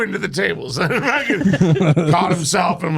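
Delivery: ordinary talk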